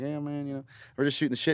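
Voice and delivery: a hint of Oklahoma bus driver accent; big Oklahoma accent. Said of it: "he's got this big old accent".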